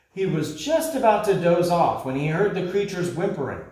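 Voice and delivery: ordinary delivery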